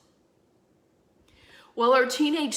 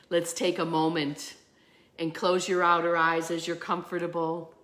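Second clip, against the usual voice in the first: guided meditation. Acted. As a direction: ease them into breathing. Leads a meditation.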